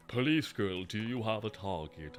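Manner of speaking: a hint of a brooding anime villain; mock dub voice. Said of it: "change what I'm doing for the voice".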